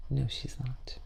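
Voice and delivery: hushed whispered aside; teasing whispered aside. Pretend. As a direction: whisper it like a secret joke.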